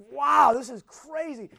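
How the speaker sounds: loud, high, drawn-out wow; acted astonishment. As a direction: react amazed, stretching it out slowly. Acting the brothers' astonished reaction.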